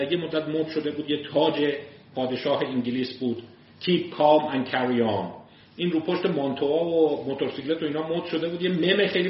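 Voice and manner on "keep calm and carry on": each word of Keep calm and carry on drawn out; slow slogan. Shown as a demonstration. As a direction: read it like a poster slogan, very slow. Quoting the famous poster. (شعار، خیلی کشیده و آهسته)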